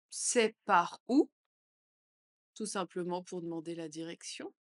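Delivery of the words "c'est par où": said slowly and evenly for learners; slow repeat. Shown as a demonstration. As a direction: stretch it out calmly. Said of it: "phrase modelled twice". (lentement, pour répéter)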